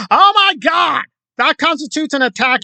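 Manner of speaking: high, hammed-up shocked shout; mock outrage. Mimicking his reaction to chat criticism, high mock-outraged shout.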